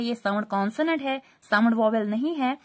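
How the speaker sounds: usual speaking voice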